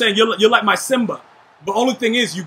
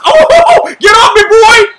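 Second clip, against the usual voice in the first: startled yell; 12 semitones higher, 11 dB louder than usual. Real, not acted. Getting tackled in the game.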